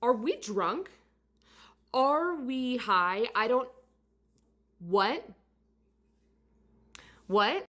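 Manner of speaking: high, rising, incredulous questions; baffled, can't believe what she's reading; bewildered disbelief. Retold or imitated. To the client on her phone, stunned disbelief. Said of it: "I feel like I was living in a twilight zone".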